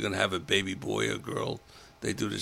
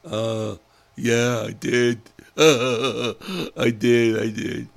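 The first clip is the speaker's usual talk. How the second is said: louder, flustered yes with a laugh; nervous loud answer; 3 semitones higher, 9 dB louder, much slower than usual. Retold or imitated. Patient flustered by the nurse, answering loudly. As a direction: blurt it out, caught off guard.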